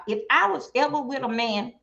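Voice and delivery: usual speaking voice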